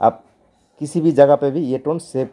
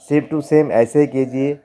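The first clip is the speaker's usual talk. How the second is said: slow, deliberate instruction; slowly; 2 semitones higher, slower than usual. Real, not acted. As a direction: spell it out slowly for beginners. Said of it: "मैं धीरे-धीरे बोल रहा हूँ।". (धीरे-धीरे समझाते हुए)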